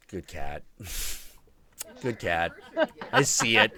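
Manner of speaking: soothing the cat. Retold or imitated. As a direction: gently praise a proud pet. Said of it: "and I'm like".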